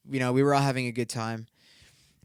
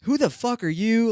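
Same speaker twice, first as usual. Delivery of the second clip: higher, louder snotty retort; higher, louder mock of the girl's retort; mock hostile girl; 8 semitones higher, 5 dB louder than usual. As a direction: snap it back as the girl. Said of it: "Then she was like".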